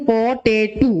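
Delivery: "পটেটো" slowly in three parts, প-টে-টো; split into syllables. Shown as a demonstration. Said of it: "তিনটা পার্টে বলি".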